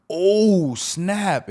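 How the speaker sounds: loud, high, drawn-out retold alarm; scared shock. Church brother seeing him clutch a pistol, scared shock. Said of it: "it was kinda scary".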